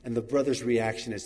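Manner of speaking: ordinary talk, nothing marked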